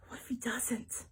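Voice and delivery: scared, breathy whisper behind a shed; breathless whisper. A narrator's reading. Pep hiding from the chaser, panting whisper. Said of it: "breathing heavily".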